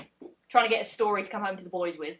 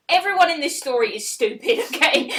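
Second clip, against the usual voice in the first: exasperated rant; 4 semitones higher, 6 dB louder than usual. Real, not acted. Mock rant at the story.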